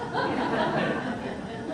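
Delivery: a high, airy burst of laughter; breathless laugh. Real, not acted. Laughing about the pandemonium.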